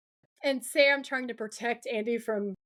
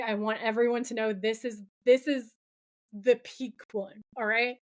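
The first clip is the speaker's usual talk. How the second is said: normal talking voice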